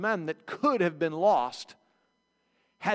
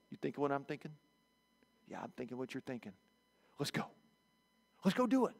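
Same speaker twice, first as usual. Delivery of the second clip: conspiratorial; 5 semitones lower, 11 dB quieter, faster than usual. Retold or imitated. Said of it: "and they're like".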